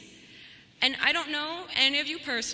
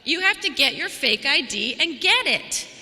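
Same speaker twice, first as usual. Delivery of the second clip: emphatic punchline; 6 dB louder than usual. Real, not acted. Punchline urging graduates.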